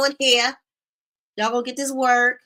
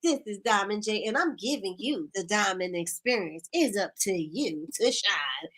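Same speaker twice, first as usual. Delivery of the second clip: imitating Felicia's intro; 5 semitones lower, 6 dB quieter than usual. Host imitates Felicia's live intro, lower and softer. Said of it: "She's like".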